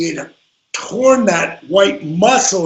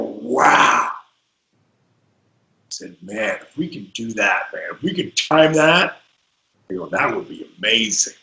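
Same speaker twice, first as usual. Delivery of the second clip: lower, softer, amazed; awed low hush; 8 semitones lower, 4 dB quieter than usual. Retold or imitated. Telling Eddie the move could be amazing, awed hush. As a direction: let the wonder hush your voice.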